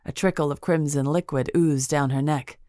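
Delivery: plain reading voice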